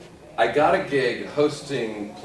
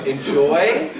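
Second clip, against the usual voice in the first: louder, questioning incredulous rise; 3 semitones higher, 6 dB louder than usual. Retold or imitated. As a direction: echo it back in disbelief. Incredulous reply to odd job offer.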